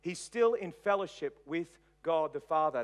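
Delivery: ordinary delivery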